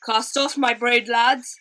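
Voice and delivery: loud, slow captain's call; crying out. A narrator's reading. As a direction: bellow the order to the crew. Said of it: "cried Rambashi".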